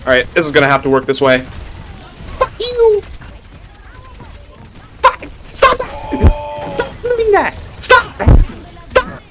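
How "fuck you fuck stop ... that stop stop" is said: rising yell. Real, not acted. Yelling at attacking enemy.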